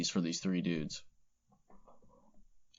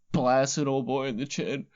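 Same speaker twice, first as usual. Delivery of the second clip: usual speaking voice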